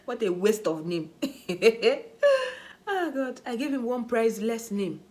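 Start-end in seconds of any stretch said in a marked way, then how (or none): 1.1-2.8 high loud burst of laughter; helpless laughter. Real, not acted. Laughing at nickname.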